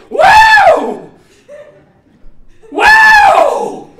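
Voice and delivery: long, piercing lottery-winner whoop; very high, loud joyful shriek; scream of joy. Acted. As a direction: whoop like you just won millions. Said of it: "that's a scream of joy".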